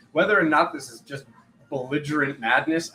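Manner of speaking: ordinary delivery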